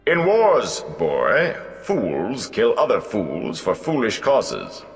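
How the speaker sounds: high, slow, disdainful bard voice; proud old bard. Acted. Gleeman scorns Mat's question, proudly. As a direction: scorn being taken for a newsmonger.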